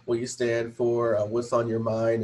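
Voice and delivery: ordinary talk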